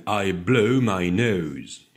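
"blow" and "nose" loud and slow, with full gliding oh vowels; showcased diphthongs. Shown as a demonstration. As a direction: relish the glides in "blow" and "nose". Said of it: "Oh, les belles diphtongues".

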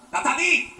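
sharp call across the room, rising; exasperated mother calling out. Acted. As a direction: call her name loudly, fed up.

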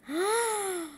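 a quick, high gasp of delight; high, excited little gasp; sudden gasp. Acted. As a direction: gasp as if something wonderful just appeared. Dee Dee spots leaf boat.

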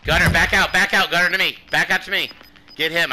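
loud, high urgent callout; shouted callout. Real, not acted. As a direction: yell it to a teammate mid-fight. Urgent callout to teammate in fight.

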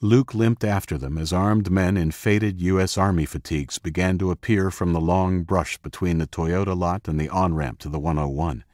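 usual speaking voice

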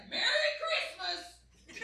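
hammed-up angry festive greeting; mad-sounding dad. Texan dad saying something nice, mock-angry bark. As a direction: bellow good wishes as if furious. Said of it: "it sounds mad".

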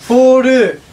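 high, loud punchline on the English words; excited. Acted. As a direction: pitch up and hit it loudly. Clerk jokes about fall collection.

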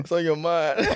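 playful laughing. Pretend.

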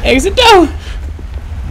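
loud panicked flight; breathless rush to the door; being chased. Real, not acted. Being chased toward the exit.

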